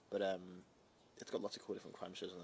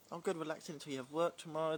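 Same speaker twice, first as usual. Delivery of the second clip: ordinary talk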